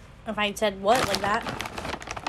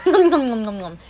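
mock eating noises; 8 dB louder than usual. Eating noises while tasting.

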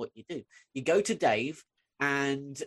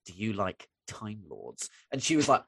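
gentle coaxing; 9 semitones lower, 3 dB quieter than usual. Retold or imitated. Comforting a bawling fifteen-year-old, gently coaxing. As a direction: drop low and soft to coax.

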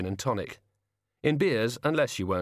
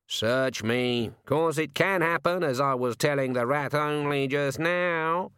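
slow, much higher croaking bird voice; talking raven; 5 semitones higher, slower than usual. A narrator's reading. The raven telling Susan her grandfather's gone mad, high slow bird voice. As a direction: speak like a sardonic raven.

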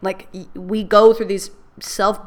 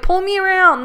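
put-on playful kid voice; 10 semitones higher, 4 dB louder than usual. Playing like a kid in water.

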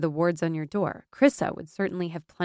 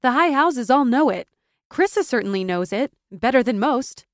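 loud, forceful rallying; fervent, emphatic conviction; impassioned urging; 5 semitones higher, 6 dB louder than usual. A narrator's reading. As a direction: press a friend to claim her birthright. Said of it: "her voice gaining momentum".

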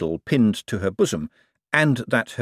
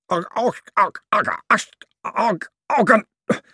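high strangled grunts round loose false teeth; choking on dentures; 11 semitones higher than usual. A narrator's reading. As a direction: gag on the dentures.

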